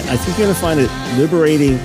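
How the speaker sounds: ordinary delivery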